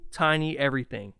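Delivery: ordinary delivery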